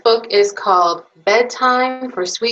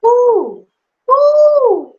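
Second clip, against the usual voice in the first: very high, loud owl hoo asked as a question; owl hoot question; 8 dB louder than usual. Acted. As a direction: hoot like a little owl, rising up. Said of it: "you ask".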